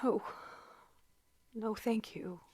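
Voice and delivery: faint whispered reply; stunned whisper. Acted. Shocked wife whispers to the telegraph clerk. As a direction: barely breathe the words.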